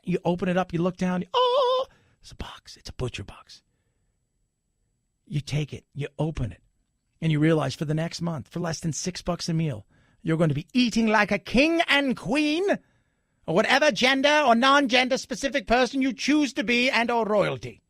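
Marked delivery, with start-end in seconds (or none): 1.3-1.9 high-pitched mock gasp of wonder; delighted surprise. Customer looking down at the doorstep, acted surprise. Acting out opening the door. 2.2-3.6 hushed, breathy reveal; awed whisper. Acted. Customer finding a box at the door, awed whisper. 10.7-17.8 higher, put-on grand voice; mock regal. Host promising meals fit for royalty, mock regal.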